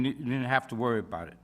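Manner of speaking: ordinary talk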